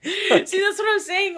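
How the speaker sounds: high-pitched, laughing agreement; real laugh lifting the voice. Real, not acted. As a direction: say it loudly through laughter.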